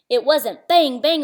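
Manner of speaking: bang said as a blunt gunshot noise. Acted. Narrator contrasting gunshot noises, blunt bang. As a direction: make bang, bang a dull gunfire sound. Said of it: "it was more like bing".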